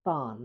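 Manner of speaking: টার্ন with no r; silent R. Shown as a demonstration. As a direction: say টার্ন as "taan". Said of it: "আর এর উচ্চারণ হবে না". (র বাদ দিয়ে টার্ন)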